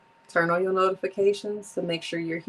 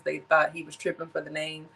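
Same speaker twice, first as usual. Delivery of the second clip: usual speaking voice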